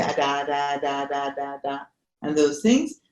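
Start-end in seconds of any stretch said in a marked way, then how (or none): 0.0-1.8 put-on baby babble. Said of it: "they start speaking and they say".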